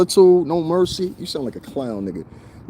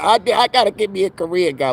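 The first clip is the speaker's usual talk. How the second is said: pitched-up parody of the woman; mocking imitation; 6 semitones higher than usual. Mocking the woman crossing the street, higher parody voice. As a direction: mimic her voice to ridicule it. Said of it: "She said, she said".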